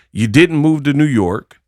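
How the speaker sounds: ordinary talk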